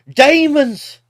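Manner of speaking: high, loud mock alarm; mock preacher cry. Streamer mocking the preacher video, high hammed-up alarm. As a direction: squeal it like a scandalized preacher.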